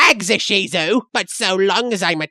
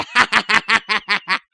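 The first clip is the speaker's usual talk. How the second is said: gleeful laugh. Acted. Gleeful kid at surface.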